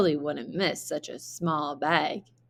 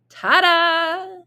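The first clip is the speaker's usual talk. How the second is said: much higher, louder fanfare; grand reveal; 11 semitones higher, 7 dB louder than usual. A narrator's reading. Narrator unveils the enormous castle, high and loud. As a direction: announce it like a magic trick.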